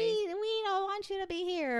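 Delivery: high, nasally, annoying puppet voice; whiny kid puppet with a nasal pinch. Retold or imitated. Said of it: "speaking in this, like, high-pitched, like, nasally, annoying voice".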